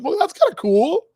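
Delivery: a touch of pleased surprise; impressed aside. Retold or imitated. Teacher reacting to the kid's Fleetwood Mac pick, mildly impressed. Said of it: "I'm going".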